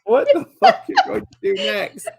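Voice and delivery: high, laughing mock outrage; cracking up while she asks; laughing disbelief. Real, not acted. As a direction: blurt it out through a laugh. Player jokes about the GM's prompt.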